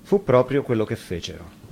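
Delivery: plain reading voice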